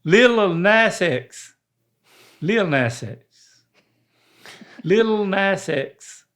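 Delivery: very slow, syllable by syllable "[name]"; sounding out words. Shown as a demonstration. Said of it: "you just say the letter X".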